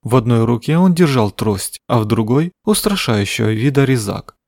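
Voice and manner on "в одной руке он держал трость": usual speaking voice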